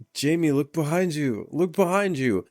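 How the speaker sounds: put-on drunk movie viewer. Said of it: "He's so fucking drunk".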